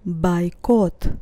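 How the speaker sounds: "Бойкот" with unstressed first O said as A. Shown as a demonstration. Teacher shows learners the Russian O rule, "Бойкот" said bai-KOT. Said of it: "That's why I pronounce it as A".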